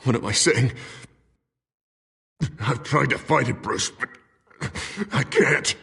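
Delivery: distressed, struggling for control; anguished pleading. Acted. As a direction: plead helplessly with a friend. Harvey surfaces, pleads with friend.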